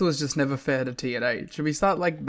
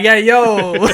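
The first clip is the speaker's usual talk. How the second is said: loud, high mock wrestler catchphrase; Johnny Polo; 7 semitones higher, 14 dB louder than usual. Podcaster answering as the wrestler, loud hammed-up catchphrase voice. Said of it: "Raven's turned into Johnny Polo".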